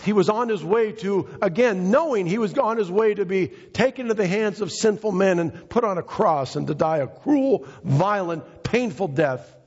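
high, driving sermon delivery; impassioned preaching. Real, not acted. Preacher telling of Jesus heading to the cross, impassioned. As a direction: preach it urgently, pitched well up.

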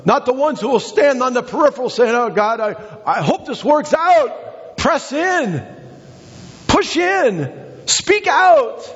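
real mounting excitement; getting excited. Real, not acted. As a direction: whip the church up. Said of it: "I know, I'm getting all excited".

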